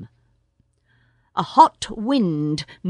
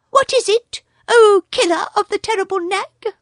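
much higher chirpy bird voice; a small proud bird boasting; much higher excited bird telling the news; Darzee the tailorbird; 14 semitones higher, 6 dB louder than usual. A narrator's reading.

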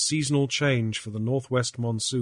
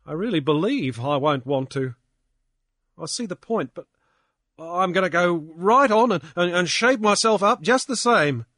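lightly voiced Pankburn; 7 semitones higher, 8 dB louder than usual. Pankburn resolved.

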